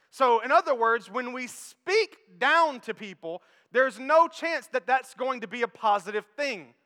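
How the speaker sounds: loud, emphatic preaching; preaching at full force. Acted. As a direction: drive the point home to the congregation.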